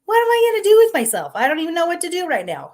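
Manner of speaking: hammed-up frantic cry of overwhelm; overwhelmed panic. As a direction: throw up your hands in helpless panic. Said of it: "And it's like".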